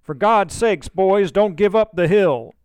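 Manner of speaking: rally the men with his last strength; rallying cry. A narrator's reading. The wounded Williams cries to his men to hold the hill, loud. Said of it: "rallies and cries".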